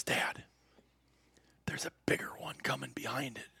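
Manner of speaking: hushed whisper so the antelope won't hear. Retold or imitated. Son whispering to his dad in the blind, whispered. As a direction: breathe the words out with no voice.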